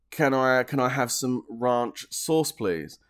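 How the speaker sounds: "ranch" drawn out as "rahnch"; "ranch" as RAHNCH in a polite British request; British pronunciation. Acted. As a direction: slowly give "ranch" a long British ah. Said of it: "I say ranch, you say ranch".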